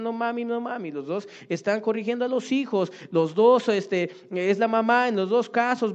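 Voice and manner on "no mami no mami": put-on child crying out. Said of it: "Los dos gritan".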